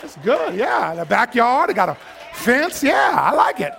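high, loud thrilled gushing; mock delight. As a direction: gush over the new house. Delighted in new house.

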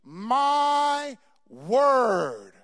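loud, high, very slow command; booming voice of the Lord; commanding God voice. Retold or imitated. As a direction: stretch every word like a divine order. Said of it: "He said".

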